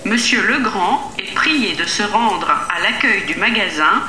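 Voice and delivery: ordinary delivery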